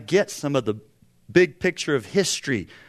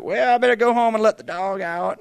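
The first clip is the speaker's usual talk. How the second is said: higher, hinted weary uncle; a polite excuse to escape; uncle's excuse; 5 semitones higher, 4 dB louder than usual. Retold or imitated. Uncle dodges dad's lecture with a light excuse. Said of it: "It's like".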